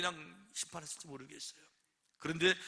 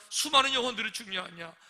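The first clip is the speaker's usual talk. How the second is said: emphatic preaching; 5 semitones higher, 9 dB louder than usual. Real, not acted. Preacher presses the point.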